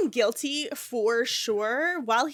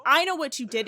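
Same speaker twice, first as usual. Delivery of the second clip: louder, the I hit hard; louder accusation; 4 semitones higher, 6 dB louder, faster than usual. Acted. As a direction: repeat it as an angry threat. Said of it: "And Loki says".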